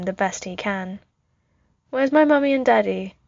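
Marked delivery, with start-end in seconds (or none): 1.9-3.2 lightly voiced child, pitched higher; a hint of a small boy asking; little boy. A narrator's reading. Shivering Tony asks the soldier for his parents, lightly childlike.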